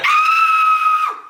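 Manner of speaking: very high, loud scream; mock scream. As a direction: scream in pain. Friend screams when cut.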